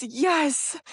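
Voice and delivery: put-on high, emphatic little-kid answer; eager toddler. Toddler answers mom's question about food, high and emphatic. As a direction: imitate an upset toddler finally understood.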